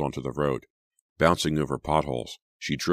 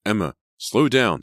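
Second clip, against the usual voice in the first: high, loud warning; alarmed, raised-voice command; urgent shout; 7 semitones higher, 7 dB louder than usual. A narrator's reading. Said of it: "Sydney shouted".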